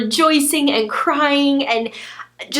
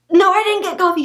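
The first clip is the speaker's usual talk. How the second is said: excited reply; 9 semitones higher, 4 dB louder than usual. Retold or imitated. Retold breathless reply to husband.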